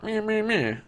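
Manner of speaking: high, dismissive meh meh meh; mock a bored grumble; mocking blah blah. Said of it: "I'm like".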